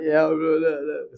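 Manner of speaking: loud, hammed-up modest protest; struggling mock humility. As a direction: play at struggling to refuse praise. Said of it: "I'd struggle".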